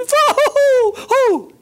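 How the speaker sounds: loud shrill jeering laugh; high squealing cackle; mocking high laugh. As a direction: laugh the dreamer down.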